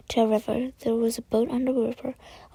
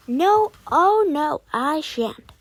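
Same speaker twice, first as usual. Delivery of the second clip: mocking refusal; 5 semitones higher, 8 dB louder, slower than usual. A narrator's reading. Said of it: "he answered".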